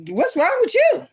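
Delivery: high startled what's wrong; lady blurts it out high; surprised quote. Retold or imitated. Store lady asking the man, startled and high.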